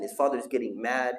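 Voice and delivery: ordinary delivery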